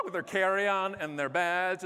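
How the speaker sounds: normal talking voice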